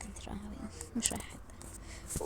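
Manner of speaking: hushed whisper. Acted. Sister whispers so nobody hears her leaving. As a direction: keep it secret, barely breathed.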